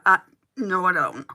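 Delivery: ordinary delivery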